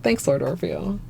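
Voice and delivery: usual speaking voice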